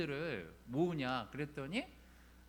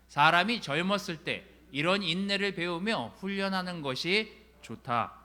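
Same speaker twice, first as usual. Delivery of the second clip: loud, measured congregational reading; projected Bible recitation in even phrases; scripture reading; 2 semitones higher, 6 dB louder than usual. Acted. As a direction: read the verses out firmly for everyone to follow. Leads congregation reading scripture aloud.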